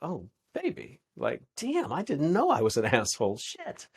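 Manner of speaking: high, teasing disbelief with a laugh; amused fake shock at being called a name; playful mock surprise. Shown as a demonstration. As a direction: play it light, dodge the fireball with humor. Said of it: "your response could be like".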